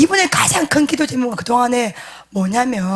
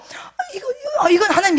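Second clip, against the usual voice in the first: much higher, half-laughing half-crying shout; flustered laughing cry; 7 semitones higher than usual. Retold or imitated. Pastor reliving his embarrassment at the prayer, laughing cry. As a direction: blurt it out, laughing in panic. Flustered during prophecy retold.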